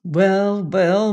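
ordinary delivery, nothing marked

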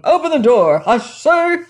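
loud, high, escalating demand; angry shout. Acted. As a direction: shout it with mounting fury. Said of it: "it was shouting".

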